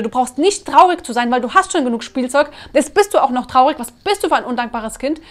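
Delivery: put-on loud, scolding rant at a child; demonstrated parental yelling. Parent yelling at a sad child, loud scolding. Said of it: "Wenn ich ihn aber anschreien würde".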